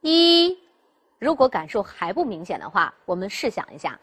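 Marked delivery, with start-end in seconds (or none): 0.0-0.6 "一" sung out much higher and louder, held as the vocal cords tighten; a teacher's tone demo of "一" pushed high and loud; high sustained yi. Shown as a demonstration. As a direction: stretch the neck and hold "一" high and loud. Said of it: "比如说".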